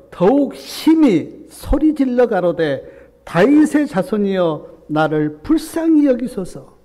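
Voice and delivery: preacher booming the beggar's plea to the church; preached shout. Shown as a demonstration.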